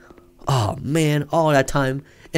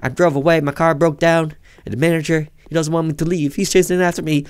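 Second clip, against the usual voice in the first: normal talking voice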